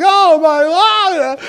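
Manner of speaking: long, high, loud drawn-out cry; slow shrieking panic; terrified scream. Retold or imitated. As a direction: wail it slowly in terror.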